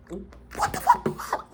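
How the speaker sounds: slow, breathless whisper of shock; stunned. Real, not acted. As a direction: breathe it out in disbelief. Hushed shock at confession.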